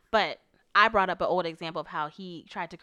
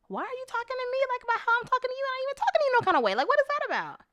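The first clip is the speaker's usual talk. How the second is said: much higher, fast exasperated protest; exasperated retort; 3 dB louder, faster than usual. Retold or imitated. Wife retelling arguing with her husband, high exasperated protest. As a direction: fire back in disbelief. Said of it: "And I'm like".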